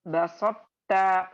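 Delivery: ordinary talk, nothing marked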